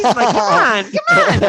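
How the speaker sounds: mock whine, loud, pitch climbing high; joking plea. Teasing the nurse about skipping the line, mock loud whine. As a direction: pretend to beg the nurse for a line cut, hammed up. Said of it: "I'm joking".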